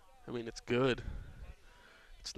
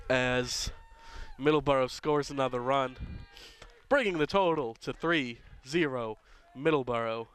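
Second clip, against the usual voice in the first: louder score announcement; announcer read; 4 dB louder than usual. Acted. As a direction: announce the run like a stadium voice.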